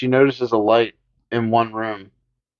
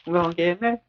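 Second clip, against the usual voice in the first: put-on high, softer little-kid boast; childish [name]; 7 semitones higher, 6 dB quieter, much faster than usual. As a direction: coo it like a proud child. Repeat in kid voice, quieter.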